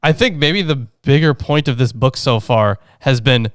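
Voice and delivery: usual speaking voice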